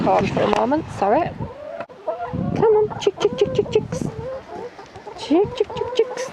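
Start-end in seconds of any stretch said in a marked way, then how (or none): 2.3-6.3 very high sing-song chicken call. Real, not acted. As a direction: call the hens in, high and lilting. Calling the hens for corn.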